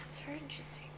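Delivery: airy whispered aside. Real, not acted. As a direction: whisper it like a secret.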